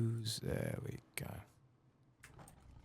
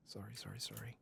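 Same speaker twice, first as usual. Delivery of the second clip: hushed, breathy-free whisper of apology; quick embarrassed whispered apologies; whispered sorry; 6 semitones higher, 10 dB quieter than usual. Real, not acted. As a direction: whisper the sorries under your breath. Apologising for running over time.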